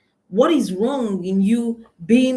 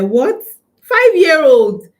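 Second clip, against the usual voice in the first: higher, much louder and slow astonished cry; shocked disbelief; 8 semitones higher, 11 dB louder, slower than usual. Real, not acted. Coach reacting to the five-year-old's retort, shocked loud cry. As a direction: blurt it out in disbelief. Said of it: "I swear, I laughed".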